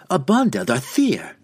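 loud, firm cry. Acted. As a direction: call it out hard. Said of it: "Jeralus cried again".

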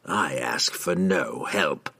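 low, soft and slow; quiet villain closing the subject; guarded assassin. A narrator's reading. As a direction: give nothing away, low and deliberate. Assassin refuses the wizard's help.

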